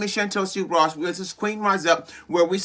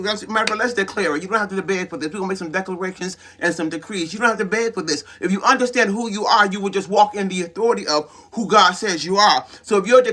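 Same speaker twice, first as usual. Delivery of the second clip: ordinary talk, nothing marked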